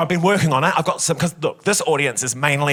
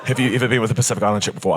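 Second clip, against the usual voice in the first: lower, quick repeat of the bloke; blokey mate, fast; 7 semitones lower, much faster than usual. Retold or imitated. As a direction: rattle it off low and fast. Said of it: "she said that".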